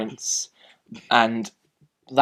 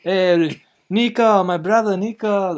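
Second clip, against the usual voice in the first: loud, high-pitched stock Russian accent; generic Russian accent; 8 semitones higher, 6 dB louder, slower than usual. Shown as a demonstration. Reviewer demonstrating a film villain, in a generic Russian accent. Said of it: "What is the Russian accent?".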